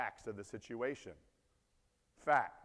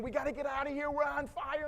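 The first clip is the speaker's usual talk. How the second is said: higher, much louder terrified yelling; panicked screaming; 14 semitones higher, 7 dB louder than usual. Retold or imitated. Wife in burning bus yelling to husband, panicked screaming. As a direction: scream to get everyone out now. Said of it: "she's panicking".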